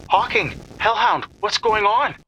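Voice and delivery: high, loud, urgent calling; alarmed call. Acted. Dancer calls to Hawking and Hellhound, alarmed.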